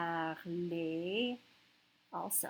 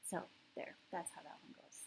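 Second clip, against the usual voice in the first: hushed whisper after the song; whispered aside; 3 semitones lower, 14 dB quieter, much faster than usual. Real, not acted. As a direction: whisper it almost to yourself.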